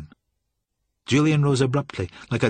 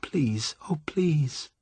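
high, whispery begging; whimpering plea; 5 semitones higher, 4 dB quieter, much slower than usual. A narrator's reading. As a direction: beg in a small, whispered whimper. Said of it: "she whimpered".

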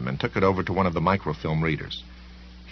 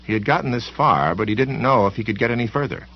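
ordinary delivery

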